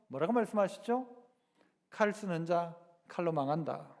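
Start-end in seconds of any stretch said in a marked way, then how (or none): 1.9-3.9 a hint of Jesus, softer and lower; gently but firmly set Peter straight; calm Jesus rebuke. Jesus calmly rebuking Peter for the sword, softer and lower.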